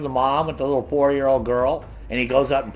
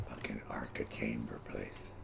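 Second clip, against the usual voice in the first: whispered grumble; 20 dB quieter than usual. Real, not acted. Muttering while gaming.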